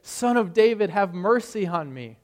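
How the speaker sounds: Son of David cried out loud and high, then settling; a beggar calling for mercy across a crowd. Retold or imitated. Blind beggar calls out to passing Jesus, a loud plea. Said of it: "he can't help but call out".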